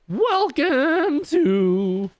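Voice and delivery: put-on high, very slow cracking teen voice; squeaky puberty voice; teenage voice. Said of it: "That's what puberty sounded like for me".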